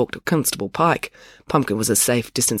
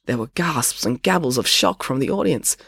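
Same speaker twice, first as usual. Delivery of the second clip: ordinary delivery, nothing marked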